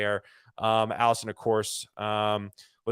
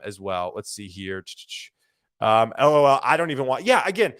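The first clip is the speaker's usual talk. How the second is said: usual speaking voice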